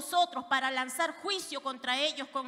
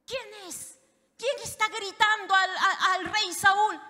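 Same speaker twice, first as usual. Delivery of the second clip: startled waking; 6 semitones higher, 7 dB louder than usual. Acted. General woken by shouting, startled higher voice. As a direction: jump up alarmed. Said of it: "se ha levantado, pero de un brinco".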